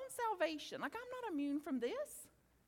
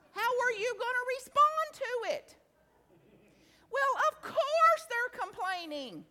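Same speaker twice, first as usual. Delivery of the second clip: louder, higher, pressing the point; emphatic; 7 semitones higher, 9 dB louder than usual. Real, not acted. Speaker challenging the audience, louder and higher. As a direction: push it out to the room.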